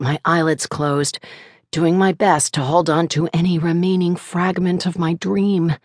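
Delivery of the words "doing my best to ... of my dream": plain reading voice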